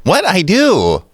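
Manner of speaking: high-pitched mock protest; put-on high voice. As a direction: pitch it way up, playfully. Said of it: "You should talk like that".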